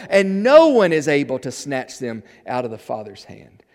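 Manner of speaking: loud, high pulpit reading of Jesus' promise; booming, insistent preaching voice; forceful scripture proclamation. Acted. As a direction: declare the verse at full force.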